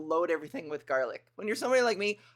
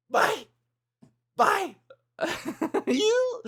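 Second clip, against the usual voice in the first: put-on squeaky little girl voice; 12 semitones higher, much slower than usual. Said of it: "she's like".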